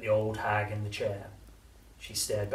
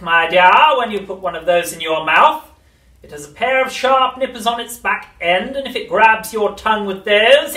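witch voice; 11 semitones higher, 18 dB louder than usual. A narrator's reading. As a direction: cackle it like an old witch.

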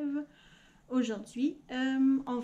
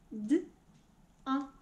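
sounds split one at a time; robot voice; 3 dB quieter, much slower than usual. Shown as a demonstration. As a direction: chop each word into sounds. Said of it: "dire gant en robot". (voix de robot qui découpe les sons)

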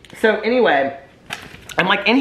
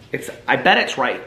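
usual speaking voice

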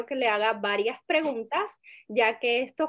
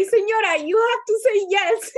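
higher and louder, half-laughing plea; mock urgent plea to a client, higher and louder; pleading with client; 8 semitones higher, 6 dB louder than usual. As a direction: beg the old client to say yes. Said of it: "and I'm like".

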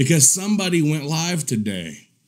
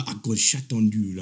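mock threat, low and softer; imitating that guy; 7 semitones lower, 5 dB quieter, faster than usual. Mocking that guy for the viewers, low imitation. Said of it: "You remember he was".

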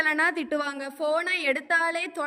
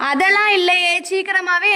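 louder, protesting that she gets up early; loud indignant denial; 7 dB louder than usual. Real, not acted. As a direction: push back hard against the accusation. Daughter denies mother's accusation. (சத்தமாக மறுத்து பேசுதல்)

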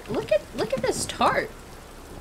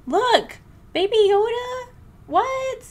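very high-pitched gushing; excited squeal; 14 semitones higher, slower than usual. Real, not acted. Streamer showing viewers a Baby Yoda shirt, squealing with excitement. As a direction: squeal over something adorable.

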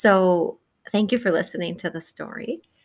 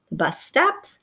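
ordinary delivery, nothing marked

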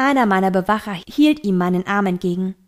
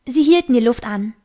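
plain reading voice

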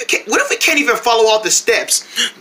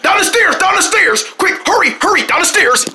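high, hurried calls; urgent calling; 10 semitones higher than usual. Acted. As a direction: urge them on.

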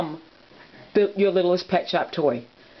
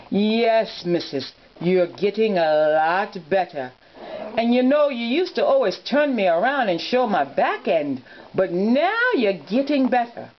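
Mister Scotty; 2 semitones higher than usual. Acted. Toy dog telling his missus she's getting better, in character voice. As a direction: play a talking toy dog with swooping pitch. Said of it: "You can see the difference in my voice".

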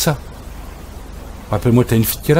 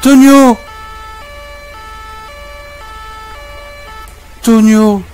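shouting the dog; much slower than usual. Real, not acted. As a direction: yell his name in alarm. Calling the dog.

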